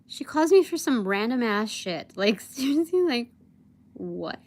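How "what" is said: normal talking voice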